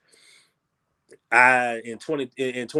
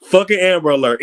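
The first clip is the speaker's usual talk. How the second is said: louder, higher repeat of "Fucking Amber Alert" with a laugh behind it; exasperated outburst; 6 semitones higher, 8 dB louder than usual. Real, not acted. Guest on a podcast, cut off by a phone alert, loud exasperated outburst. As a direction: blurt "Fucking Amber Alert" out in amused annoyance.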